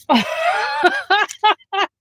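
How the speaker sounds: high burst of laughter; long high cackling laugh; real helpless laugh. Real, not acted. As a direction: crack up, unable to help it. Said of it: "I cannot help it".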